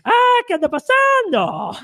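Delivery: high, otherworldly crone voice; ghostly old woman. Acted. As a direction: sound like a grandmother from beyond the grave. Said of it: "Una voz de ultratumba de una anciana".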